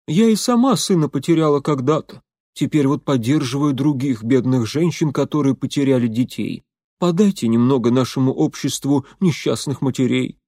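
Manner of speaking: put-on begging old woman, Irish accent. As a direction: plead for money in an Irish accent. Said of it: "начала она с сильным ирландским акцентом".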